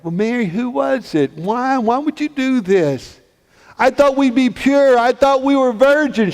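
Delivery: high, anguished demand for answers; hurt questioning. Acted. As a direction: confront her, pitch climbing. Joseph confronts Mary, hurt.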